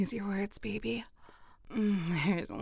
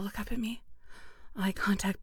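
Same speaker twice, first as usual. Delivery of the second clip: ordinary talk, nothing marked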